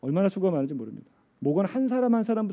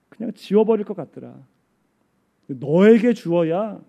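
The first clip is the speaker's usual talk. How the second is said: voice of God answering; 5 semitones higher, 6 dB louder than usual. Retold or imitated. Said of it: "하나님이 이런 말씀을 주셨대요".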